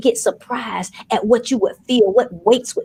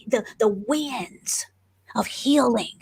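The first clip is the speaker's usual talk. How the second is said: whisper; 6 dB quieter, slower than usual. Acted. Preacher hushes mid-phrase.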